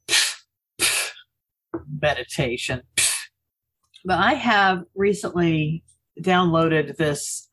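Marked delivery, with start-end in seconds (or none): 0.1-3.3 breathy pfft, low muttered meditation; scoffing dismissal. Retold or imitated. Dismissing friends who suggest meditating, quiet scoff. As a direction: scoff at the whole idea. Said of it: "being like".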